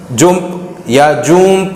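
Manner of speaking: जुम्प and जूम with an oo vowel; wrong pronunciations. Shown as a demonstration. Teacher lists what not to read, mispronounced जुम्प या जूम. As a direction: say जुम्प, then a longer जूम. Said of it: "नहीं पढ़ते".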